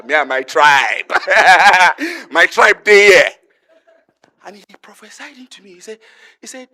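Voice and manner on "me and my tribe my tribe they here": loud, drawn-out rallying cry to the crowd; excited shout. Real, not acted. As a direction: shout it out and laugh through it, stirring the room. Crowd-stirring tribe shout.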